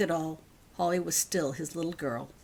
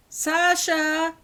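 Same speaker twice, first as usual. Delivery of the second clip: high, loud call for the cat; 12 semitones higher, 8 dB louder than usual. A narrator's reading. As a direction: call the cat across the yard. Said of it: "she called".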